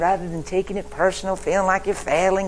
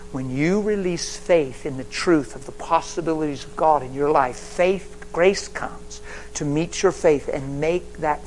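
very slow, weighty preaching; slow teaching; slower than usual. Shown as a demonstration. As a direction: spell it out word by word. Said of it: "Listen carefully, I'm teaching right now".

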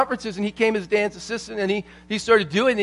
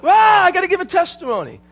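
high, loud excited outburst; imitated scream; 11 semitones higher, 3 dB louder than usual. Todd at an exercise, imitated scream. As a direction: cry out as if bursting to speak. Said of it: "screaming out".

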